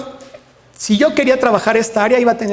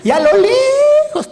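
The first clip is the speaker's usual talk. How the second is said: drawn out and louder, building suspense; dramatic; 7 dB louder, slower than usual. Retold or imitated. Telling the audience about the distant answer: drawn-out excited. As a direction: stretch it out with high excitement.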